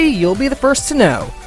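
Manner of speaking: normal talking voice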